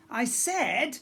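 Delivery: put-on a pirate girl getting cross; goblin girl voice. A narrator's reading. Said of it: "came the girl's voice".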